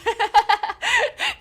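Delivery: high, loud rising cackle; high laugh. Acted. Streamer laughs at own joke, high cackle. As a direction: cackle in the put-on voice.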